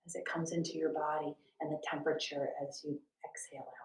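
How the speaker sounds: quick soft whisper; whispered meditation cue. Shown as a demonstration. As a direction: let it fall to a breathy hush.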